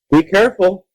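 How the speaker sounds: high, loud caution; warning. A narrator's reading. Neighbor warns child before the stairs, cautioning. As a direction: call out a warning. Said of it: "he warned".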